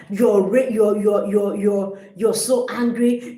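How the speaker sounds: ordinary delivery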